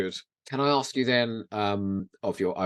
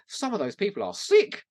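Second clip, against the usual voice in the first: ordinary delivery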